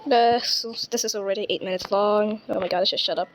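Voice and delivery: ordinary delivery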